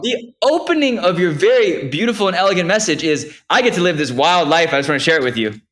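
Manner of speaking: high, loud playful quote; loud, high teasing read-out; excited teasing. Real, not acted. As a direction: read his words back at him with glee. Teasing friend, quoting message.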